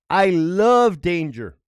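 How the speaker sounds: I love danger drawn out like a motto; slow declaration. Real, not acted. Vlogger to viewers, announcing his creed slowly. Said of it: "I swore in the Bible".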